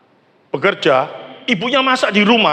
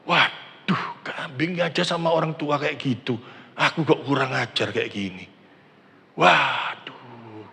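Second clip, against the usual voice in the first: soft, sorry murmur, much quieter; remorseful; 5 semitones lower, 8 dB quieter than usual. Acted. Guilty son watching the goat, quietly ashamed. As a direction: hang your head and confess it softly to yourself. Son's remorseful inner monologue.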